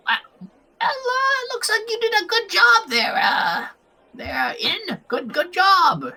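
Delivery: high-pitched, halting praise; high elf woman's polite encouragement; small elf woman. Acted. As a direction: put on a high little voice and congratulate awkwardly. Voicing small elf woman congratulating Inn.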